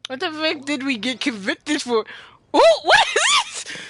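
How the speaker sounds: usual speaking voice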